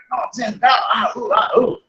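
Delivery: high, sharp panting sounds of rising anger; angry heavy breathing demo. Shown as a demonstration. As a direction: pant out the anger, high and forceful. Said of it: "क्रोध आने से देखा होगा कि आपकी breathing बढ़ती है". (गुस्से में तेज़ हाँफने की आवाज़)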